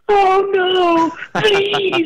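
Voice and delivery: high, whiny mock cry of "please"; mock whimpering protester. Mocking Kavanaugh protesters to a friend, in a high fake whimper. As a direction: ham up a protester's pleading wail. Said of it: "people screaming and banging on the door".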